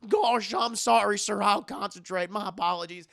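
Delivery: apologetic Goofy. Retold or imitated. Goofy apologizing to his boss, flustered and loud. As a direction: fumble out an apology. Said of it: "he's like".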